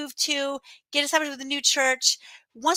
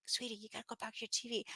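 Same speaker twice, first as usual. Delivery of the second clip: soft, quick, lowered hush; hushed mom aside; 4 semitones lower, 19 dB quieter, faster than usual. Retold or imitated. Mom to three-year-old during class, retold hushed aside. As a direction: quietly shoo a child away mid-class.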